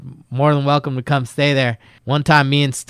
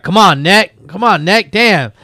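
louder, higher, impatient urging through a door; impatient banging friend; 4 semitones higher, 5 dB louder than usual. Retold or imitated. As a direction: hurry him up, raised and pushy. Stone knocking on stall door.

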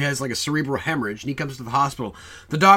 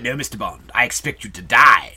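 low, menacing parody villain; dramatic Goldfinger; 6 semitones lower, 3 dB louder than usual. Shown as a demonstration. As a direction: say it low and dramatic, punching the end. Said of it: "We always think of it".

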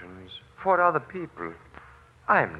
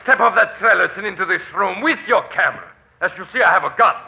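menacing command; 6 semitones higher, 7 dB louder, faster than usual. Acted. Threatens with gun.

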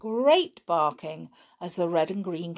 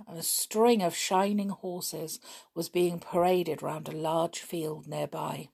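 ordinary delivery, nothing marked

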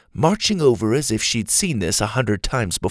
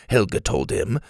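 usual speaking voice

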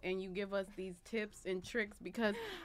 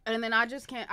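ordinary talk

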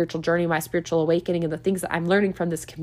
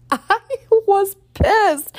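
high, loud laughing outburst; laughing indignation; 6 dB louder, much slower than usual. Real, not acted. Laughing to listeners about being pissed. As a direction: laugh it out, mock outraged.

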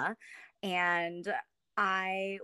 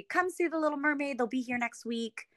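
mock promo voice; 7 semitones higher than usual. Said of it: "it was supposed to be".

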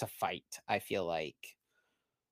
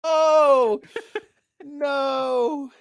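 high, loud cry of dismay; drawn-out, high outcry with a laugh; 9 dB louder than usual. Real, not acted. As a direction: cry out high as the guess is lost. Reacts to losing the guess.

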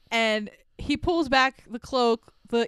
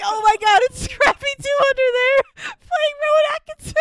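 high, loud mock shock; hammed-up cartoon shock; mock shocked gasp; 11 semitones higher, 6 dB louder than usual. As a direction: act stunned at the unmasking. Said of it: "And you're like".

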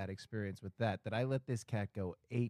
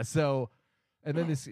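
usual speaking voice

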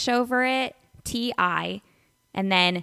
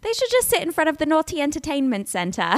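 higher, amused English accent; 5 semitones higher than usual. Shown as a demonstration. Host showing her accent warm-up phrase, English accent. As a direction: put on a playful English accent, laugh close behind. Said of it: "that's how I would get into my accent".

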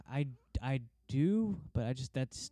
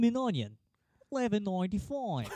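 Outback ad voice; 7 semitones higher than usual. Retold or imitated. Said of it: "the guy in the Outback commercial".

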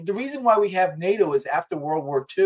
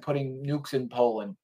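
ordinary delivery

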